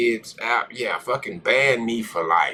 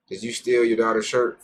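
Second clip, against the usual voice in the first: ordinary talk, nothing marked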